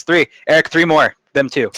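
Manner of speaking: calling out. Acted. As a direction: holler the order over the noise. Drunk man ordering drinks loudly.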